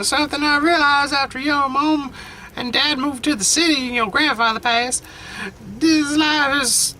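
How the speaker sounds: put-on a frail old grandmother, tender and teary; grandma voice. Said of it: "only one I can go for ... old elderly lady".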